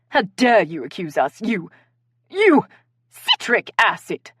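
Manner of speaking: indignant husky character voice. A narrator's reading. Accused guest lashes back at her accuser, husky indignation. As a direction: take offence huskily, with stops and starts. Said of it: "who used a husky voice for her character".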